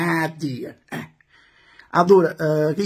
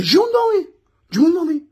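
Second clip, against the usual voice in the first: excited; 11 semitones higher, 4 dB louder than usual. Real, not acted. Stupore sull'arrivo del giocatore.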